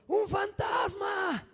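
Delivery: high, drawn-out startled yell of fright; frightened cry. Retold or imitated. Disciples seeing Jesus walk on the sea, a terrified high yell. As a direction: shriek it slowly like you've just seen a ghost. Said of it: "se turbaron diciendo".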